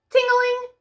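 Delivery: high sing-song ting-a-ling; bright high bell chime; bell ring. A narrator's reading. Bell ringing in story.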